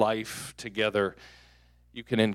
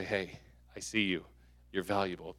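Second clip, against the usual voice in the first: soft; 4 semitones lower, 9 dB quieter than usual. Shown as a demonstration. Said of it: "modelling what to say".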